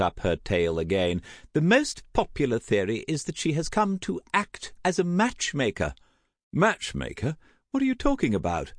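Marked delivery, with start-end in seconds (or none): 1.5-5.9 high, swooping society lady; theatrical high-pitched gossip; effusive gossiping aunt. Acted. Tessa takes up her tale. 6.5-8.7 startled, higher-pitched query; rising disbelief, then a quick demand; alarmed. A narrator's reading. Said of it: "A sizzle of alarm".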